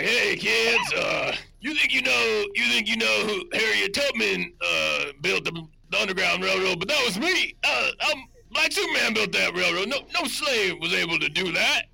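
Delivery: loud, high-pitched caricature voice, hammed up. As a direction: boom it out as an exaggerated superhero impression. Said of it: "I thought I would do my impersonation for you".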